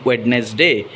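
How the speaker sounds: "Wednesday" said as WED-nes-day; three syllables in "Wednesday", d heard; first D sounded. Shown as a demonstration. Teacher showing the mistake, "Wednesday" as wed-nes-day. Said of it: "We don't have to say the first da".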